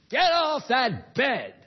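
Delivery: slow, loud, high bark at the dog; stern shouted command; sharp command. Shown as a demonstration. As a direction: order the dog off the bed.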